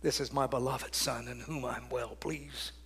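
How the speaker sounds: whispered, soft and low; a hushed whisper of the Father's blessing; reverent whisper. Acted. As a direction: breathe God's words in awe. Quoting God's words, reverent.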